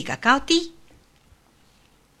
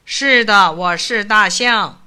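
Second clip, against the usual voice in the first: elephant voice; 6 dB louder, slower than usual. A narrator's reading. The elephant answers.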